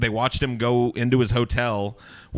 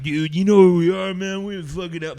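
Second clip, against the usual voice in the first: put-on drunk talk; 8 semitones higher, 5 dB louder than usual. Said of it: "having this conversation like".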